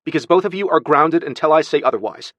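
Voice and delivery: loud, high pronouncement; stern scolding. Acted. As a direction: lay down a punishment firmly. Aunt grounds the boy.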